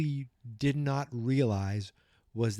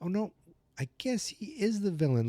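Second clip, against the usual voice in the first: retold dawning realization; 9 semitones higher than usual. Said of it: "I realized the whole time".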